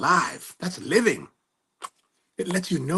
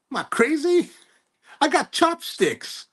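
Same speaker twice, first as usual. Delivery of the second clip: much higher, drawn-out mock outrage; mock incredulous rant; 11 semitones higher, slower than usual. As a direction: act baffled and put out, stretching it. Mock rant about pad thai.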